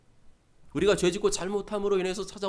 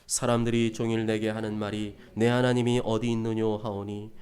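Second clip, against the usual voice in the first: low, measured reading voice; scripture reading; 6 semitones lower than usual. Acted. Preacher leading the congregation in verses, solemn reading. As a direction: read the verse aloud, low and evenly.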